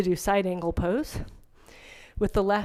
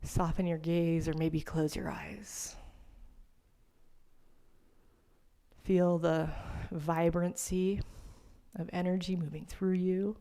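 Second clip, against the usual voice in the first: soft, lowered yoga-rest voice; guided meditation; 2 semitones lower, 5 dB quieter than usual. Real, not acted. Yoga teacher settling students into seated rest, soft meditation voice. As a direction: ease them into stillness, quietly and with long pauses.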